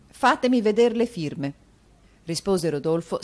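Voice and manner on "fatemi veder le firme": higher, louder curt repeat; impatient. Acted. Rodolfo to Mantalini, impatiently demanding the signatures. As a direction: demand it, out of patience. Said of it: "stendendo impaziente la mano".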